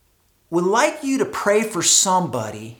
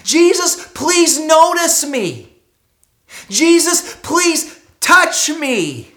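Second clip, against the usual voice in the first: higher, louder, slow desperate shouts; desperate pleading cry; 8 semitones higher, 6 dB louder, slower than usual. Acted. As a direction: beg him not to leave, crying out.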